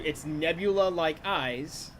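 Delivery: ordinary talk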